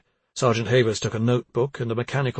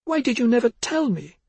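pained plea; 12 semitones higher, 4 dB louder than usual. Acted. Mother pleads.

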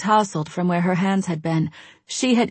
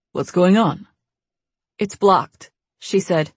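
plain reading voice

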